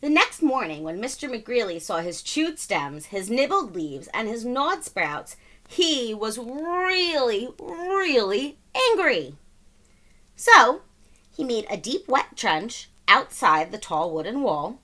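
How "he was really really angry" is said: slow, drawn-out really; emphatic. A narrator's reading. Narrator building up McGreely's anger, slow and emphatic. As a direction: lean hard on really.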